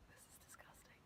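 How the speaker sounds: a hushed, disgusted whisper; soft disgusted aside; mock disgusted whisper. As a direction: whisper your disgust under your breath. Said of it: "I'm like".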